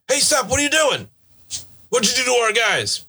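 high-pitched yell without extra volume; distant yelling. Acted. Game master voices pursuers spotting body draggers, mock yelling. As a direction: call out as an alarmed pursuer. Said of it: "There is a bit of yelling now".

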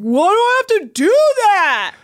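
high, loud whiny protest, hammed up; mock complaining. Mocking how everyone complains about their work, mock whine. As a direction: wail it out as an exaggerated complaint. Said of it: "everyone seems always being like".

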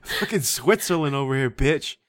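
loud laughing words, slow; cracking up at her own joke. Real, not acted.

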